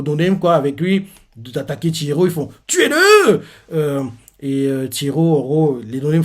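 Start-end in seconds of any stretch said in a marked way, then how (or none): 2.7-3.4 cri très aigu et fort; cri de guerre. Retold or imitated. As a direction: hurle l'ordre de tuer. Hommes de main chargent. (les hommes de main crient en chargeant)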